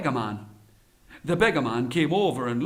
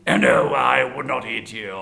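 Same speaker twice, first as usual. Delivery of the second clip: sly tiger; 5 semitones lower than usual. Acted. Tiger falsely reassuring the Brahmin, slyly. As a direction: deny it, then chuckle low.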